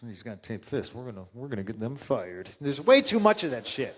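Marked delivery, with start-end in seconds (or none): 0.1-2.5 softer, lower mock whine; mock petty manager. Mocking management's reaction, soft, lower sneer. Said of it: "instead of being like".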